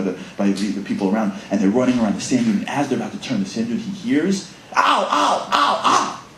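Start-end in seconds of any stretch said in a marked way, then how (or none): 4.8-6.2 high mock cries of pain; hammed-up ow ow; pained yelps. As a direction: yelp like a hurt soldier. Buddy crying out in pain.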